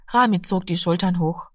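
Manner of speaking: ordinary delivery, nothing marked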